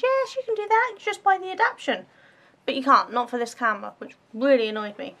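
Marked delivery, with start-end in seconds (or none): 0.0-2.0 pushy salesman voice. Retold or imitated. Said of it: "the guy at the camera shop says".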